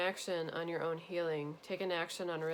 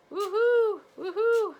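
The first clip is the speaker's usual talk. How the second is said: whooping much higher and louder; excited cheer; 12 dB louder, slower than usual. Real, not acted. Psychic cheering viewers' coming soulmates, whooping loud and high. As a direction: cheer it out like a celebration.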